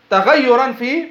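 usual speaking voice

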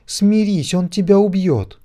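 very high, loud imploring cry; plead, terrified for her god; desperate pleading. A narrator's reading. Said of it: "взмолилась она". (отчаянная мольба)

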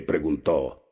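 usual speaking voice

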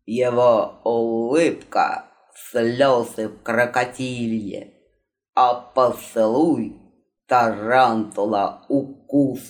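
slow, low stagey recital; theatrical declaiming. Acted. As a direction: declaim it like a ham actor. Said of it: "И он стоически продолжал".

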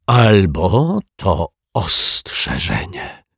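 very slow, ominous half-whisper; halting, spooked delivery, word by word; frightened whisper. A narrator's reading. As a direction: draw it out in hushed dread. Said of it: "wyszeptał pobladły raptem dozorca".

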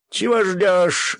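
loud, very high, drawn-out yell; Gendzo shouting. A narrator's reading. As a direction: shout impatiently at the top of the range. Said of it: "закричал Гензо". (пронзительно высокий крик)

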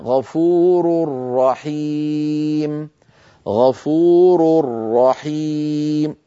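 very slow "غفور رحيم", tanween merged into a doubled ra; recited with idgham. Shown as a demonstration. Teacher reciting the tanween example very slowly with merged nun. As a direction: say "غفور رحيم" as "ghafurur-rahim", no n. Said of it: "ونرى مثال أمامنا".

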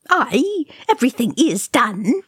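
high, swooping disgruntled echo; grumbling mutter. A narrator's reading. Oin answering Thorin, high-pitched grumble. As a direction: throw it back with pitch jumping up. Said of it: "Oin muttered".

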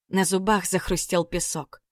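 usual speaking voice

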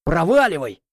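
sharp high angry order; growling. Acted. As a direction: snarl him out. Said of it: "рычу я парню".

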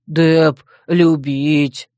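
slow, heavy brute voice; primitive brute. A narrator's reading. Dep grins at the girl, brutish drawl. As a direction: drag out each simple word. Dep declares love to Joe.

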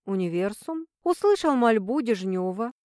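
plain reading voice

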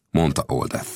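usual speaking voice

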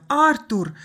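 high, loud cry of a name; distressed shout; alarmed call. A narrator's reading. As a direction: call out to a collapsed brother. Sister calls to her convulsing brother.

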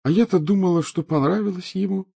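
put-on high, whimpering ghost-girl voice; plaintive ghost child. A narrator's reading. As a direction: complain pitifully, like a lonely ghost. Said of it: "жалобно продолжала Миртл".

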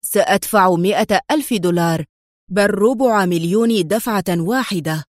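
plain reading voice

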